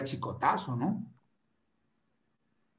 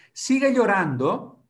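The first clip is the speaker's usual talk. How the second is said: dramatic emphasis; 8 semitones higher, 6 dB louder, slower than usual. Acted. Dramatic repeat, high and loud.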